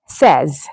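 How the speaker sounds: "says" with a short e, rhyming with fez; correct pronunciation. Shown as a demonstration. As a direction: say "says" as sez, not like "say". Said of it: "that's the correct pronunciation of that word".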